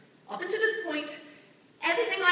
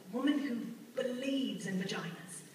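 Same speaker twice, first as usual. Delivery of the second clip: ordinary talk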